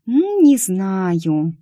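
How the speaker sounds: very slow, stretched-out doubt; hum, then drag the words out; hesitant drawl. Acted. Picky client unsure about the next man, drawling hesitantly.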